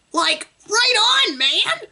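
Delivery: high, drawn-out cartoon voice attempt; Shaggy. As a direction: squeak it out slowly, stretching the words. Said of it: "I'll just stick to my normal voice".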